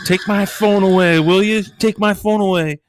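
put-on high, loud teen yell; daughter yelling. Daughter yelling at her dad to take her phone, loud high yell. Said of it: "She's yelling".

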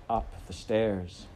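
ordinary delivery

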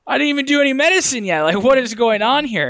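high, loud and fast, baffled at her own tears; bewildered outburst. Retold or imitated. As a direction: blurt it out fast and high, caught off guard by the emotion. Retold bewildered thought at breathwork.

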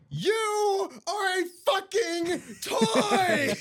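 very high, slow screaming rant; furious scream. Pretend. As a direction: scream it, cracking with laughter. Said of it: "Woody when he goes".

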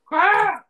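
high, loud yell of frustration; mock angry scream. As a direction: scream out anger at yourself. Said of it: "I was so angry with myself".

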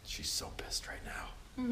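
hushed unvoiced aside; whispering. Real, not acted. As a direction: whisper it behind her back. Aside about a student eating.

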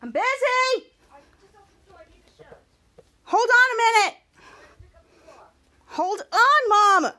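loud, high call to someone in another room; real shout at mom. Real, not acted. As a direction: yell it out to mom who's interrupting. Calling out to mom offscreen.

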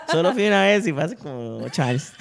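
talking through real laughter; laughing. Real, not acted. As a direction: laugh while you say it. Laughing at own joke.

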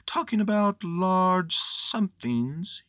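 high, slow, dreamy bear; dreamy Pooh. A narrator's reading. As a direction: drift off slowly in a high voice. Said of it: "he went on dreamily".

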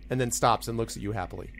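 normal talking voice

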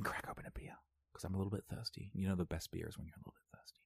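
hushed, breathy whisper. Real, not acted. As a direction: whisper it like a secret. Whispering about opening beer.

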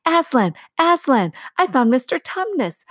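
high, loud, eager calls; excited shouting. A narrator's reading. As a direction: call out with joy. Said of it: "rushing upstairs shouting".

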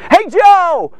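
loud, high hail across a distance; calling out. Acted. Imagining people crying out to someone, loud high call. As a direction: yell it out to get someone's attention. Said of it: "They're all crying out".

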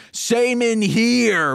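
high, drawn-out call; calling from another room. Acted. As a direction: call it through to the next room, stretched out. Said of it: "Carol from the other room says".

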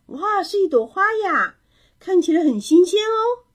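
high, loud, impressed exclamation; delighted admiration. A narrator's reading. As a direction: exclaim with delight over a find. Neighbour admires the flower. (高声惊喜地赞叹)

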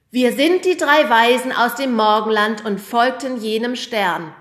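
loud, high proud announcement; announcing. A narrator's reading. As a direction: declare the line to the audience. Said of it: "verkündete Timo".

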